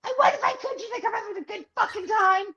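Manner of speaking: high, loud retold outburst; yelling back at truckers over the alarm, exasperated; upset outburst. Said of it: "And now I'm getting upset".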